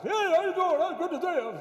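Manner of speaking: high, loud Bullwinkle-like voice; Father Fred. Acted. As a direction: mimic a pastor who sounds like a cartoon moose. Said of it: "That's how he talks".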